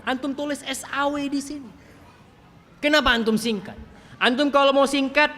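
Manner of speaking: high, insistent sermon delivery; impassioned preaching. Real, not acted. Preacher scolding those who abbreviate the blessing, impassioned preaching.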